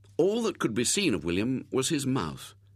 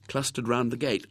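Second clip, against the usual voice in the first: ordinary delivery, nothing marked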